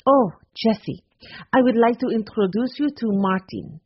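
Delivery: put-on proud, lightly accented young woman; lightly accented Simone proudly introducing her friend; proud foreign accent. A narrator's reading. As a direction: introduce him with pride, in a lilting French accent. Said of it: "Simone said proudly".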